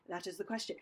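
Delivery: low, soft line reading; hushed take. Shown as a demonstration. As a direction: drop the voice right down. Drill with contrasting takes.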